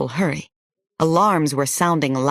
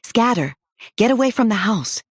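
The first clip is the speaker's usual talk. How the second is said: urgent shout; 7 semitones higher than usual. Acted. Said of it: "I pushed and shouted behind the kids".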